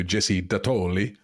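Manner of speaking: a light Italian accent on Jesse Datoli, the name Datoli rolled the Italian way. Shown as a demonstration. Co-host announcing Sydney's pick in a hint of an Italian accent. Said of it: "I put Italian accents on the ones".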